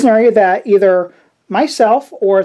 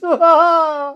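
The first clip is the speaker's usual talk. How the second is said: laugh; 9 semitones higher than usual. Real, not acted. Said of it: "That's still too Krusty the Clown".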